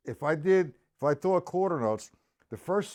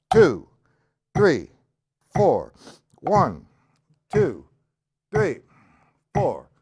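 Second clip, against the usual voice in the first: loud, separated counting locked to the beat; quarter-note count; 9 dB louder, much slower than usual. Shown as a demonstration. Drum teacher counting along with the metronome, loud steady count. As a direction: call out each number firmly in slow, even time. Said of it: "with quarter notes".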